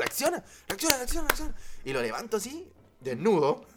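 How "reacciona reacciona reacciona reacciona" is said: urgent pleading. Retold or imitated. Slapping friend awake.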